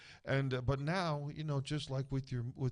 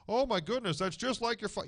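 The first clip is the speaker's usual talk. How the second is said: caller's voice; 10 semitones higher, 5 dB louder than usual. Retold or imitated. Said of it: "I can give you a line".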